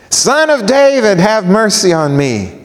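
insistent high cry, dropping toward the end. Acted. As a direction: keep crying out despite being hushed. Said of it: "he kept crying all the more".

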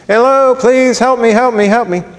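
high-pitched beggar's plea; begging. Acted. Blind beggar calling to passers-by, high and pleading. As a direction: call out for help like a roadside beggar.

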